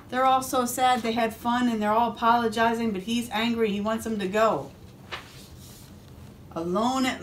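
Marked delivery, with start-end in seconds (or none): none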